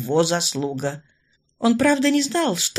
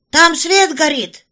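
loud, higher, slow astonished cry; astonished exclamation; 8 semitones higher, 7 dB louder, slower than usual. Acted. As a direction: call out in sudden surprise. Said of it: "с изумлением воскликнул".

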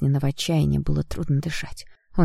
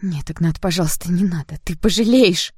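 urgent plea building louder and higher; pleading cry; 4 semitones higher, 3 dB louder than usual. A narrator's reading. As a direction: beg him to stop, rising to a cry. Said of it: "закричала девушка".

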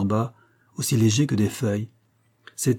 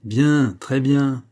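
sarcastic; 3 semitones higher, 5 dB louder, much slower than usual. A narrator's reading. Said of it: "ton légèrement sarcastique".

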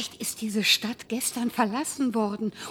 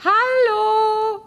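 calling out; 7 semitones higher, 4 dB louder than usual. Acted. Calling out in empty place.

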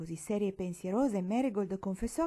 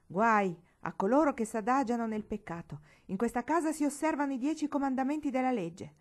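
raised voice, righteous wrath; stern denunciation; 5 semitones higher, 5 dB louder than usual. A narrator's reading. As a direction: thunder like a fiery preacher. Uncle condemns sinners at table. (severo, predicando con sdegno)

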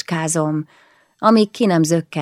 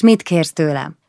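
usual speaking voice

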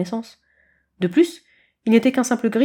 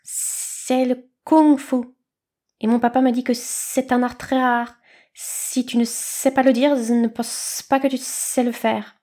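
slow, higher hissing snakelet; hissing little snake; 4 semitones higher, slower than usual. A narrator's reading. Young snake lectures kitten from the leaves, slow and hissing. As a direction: draw out each word like a lisping snake.

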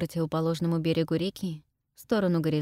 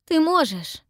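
urgent encouragement; 10 semitones higher, 5 dB louder, slower than usual. A narrator's reading. Encouraging Pippa.

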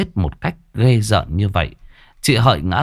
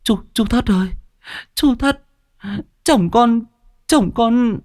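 high, tearful wailing plea; wife begging the village chief, near sobbing; whimpering plea; 14 semitones higher than usual. A narrator's reading. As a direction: cry out for help on the edge of tears. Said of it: "chị Hợi vội vàng chạy ra mếu máo".

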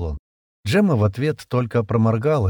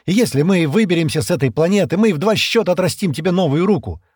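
urgent, higher and louder persuasion; hurriedly adding; 8 semitones higher, 5 dB louder than usual. A narrator's reading. Стэн promises Шира a new arm, urgent persuasion. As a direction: rush to persuade with raised voice. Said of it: "быстро добавил он".

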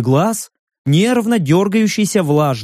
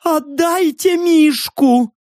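high, drawn-out wail; desperate demand; 12 semitones higher, slower than usual. A narrator's reading. Stranger begs for his bear, desperate wail. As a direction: wail for it back, stretching the words.

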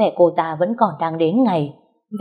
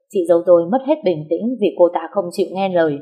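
ordinary delivery

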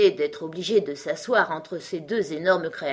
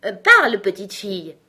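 dreamy order; 2 semitones higher, 9 dB louder than usual. A narrator's reading. Unicorn ordering Alice to speak, dreamily commanding. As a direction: command it, then settle back. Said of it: "d'un air rêveur et ordonna".

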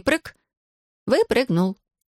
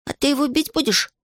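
ordinary delivery

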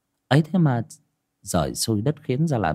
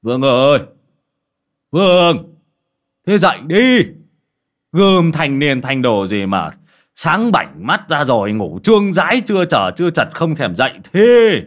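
higher, much louder angry shouting father; father shouting his son awake, louder and louder; loud calling; 6 semitones higher, 8 dB louder than usual. Acted. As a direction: bellow to wake a lazy son. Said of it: "lớn tiếng gọi".

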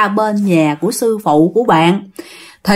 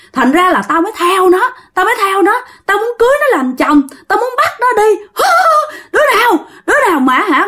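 high-pitched, agitated ghost voice; ranting possessed spirit; 8 semitones higher than usual. Acted. Possessed spirit claims the living man as husband, high agitated rant.

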